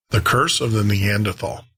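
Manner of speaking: usual speaking voice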